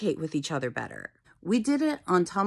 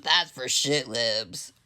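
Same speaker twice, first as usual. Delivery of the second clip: mock dude bro voice; 8 semitones lower, slower than usual. Mock dude bro voice, low, slow, far.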